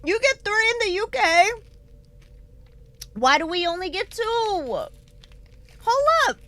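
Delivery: playfully indignant protest. Real, not acted. Outraged at getting fewer.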